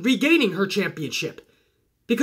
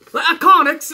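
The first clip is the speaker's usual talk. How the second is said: a quick, hammed-up Australian accent on "Iconics", higher and louder; bad Australian accent; 6 semitones higher, 6 dB louder than usual. Shown as a demonstration. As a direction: toss off a clumsy Aussie twang. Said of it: "that was a horrible Australian accent".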